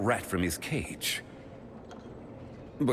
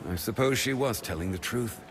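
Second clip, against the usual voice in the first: normal talking voice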